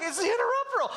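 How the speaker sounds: put-on very high, prim little child reminding; high child voice, correcting mom; kid voice. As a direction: squeak it like a know-it-all kid.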